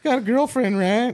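put-on high, creepy old man voice; high-pitched mock-sinister impression; prying old man. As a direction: imitate an old man fishing with menace. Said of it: "Yeah, he's like".

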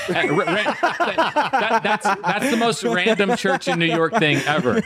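high, slow, laughing through each word; words squeezed out between laughs. Real, not acted. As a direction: talk while cracking up at a friend's watch. Laughing while talking.

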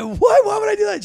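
high, loud shocked outcry; shocked protest. Retold or imitated. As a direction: act stunned at the accusation. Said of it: "I said".

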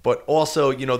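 ordinary delivery

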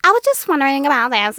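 high, soft, polite mock phone manner. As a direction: put on mama's proper voice for strangers.

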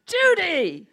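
loud shocked cry; alarmed shout. Acted. Griffin shouting at Judy in shock. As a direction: yell her name in alarm.

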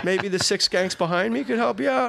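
high-pitched mock helpfulness; sarcastic retort. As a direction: offer the help with high, biting sarcasm. Acted sarcastic retort to canvasser.

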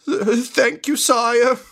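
wheezing guard. Acted. Slapped guard wheezing his thanks. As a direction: squeeze it out like you've been winded. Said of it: "he says".

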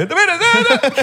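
high, put-on shout from a car; hollered like someone leaning out a car window; imitating a passing fan. Host imitating drivers yelling at the guest, mock shout. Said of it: "Te pasan en el carro que".

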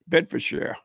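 measured read-aloud narration; ghost story read aloud. Acted. Host reading a Christmas Eve ghost tale, narrating. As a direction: read it out as a local legend.

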